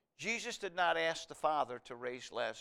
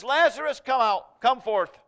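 high, booming shout of command; loud cry; 8 semitones higher, 14 dB louder than usual. Retold or imitated. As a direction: call the dead man out at full voice. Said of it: "he cried with a loud voice".

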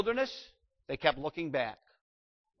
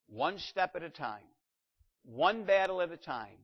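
usual speaking voice

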